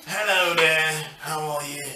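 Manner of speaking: low, nasal British greeting; nasal British accent. Acted. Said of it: "You have a nasal issue".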